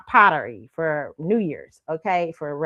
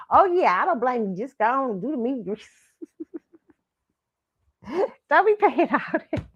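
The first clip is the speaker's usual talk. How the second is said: grandma voice; 6 semitones higher than usual. Retold or imitated. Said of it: "she said".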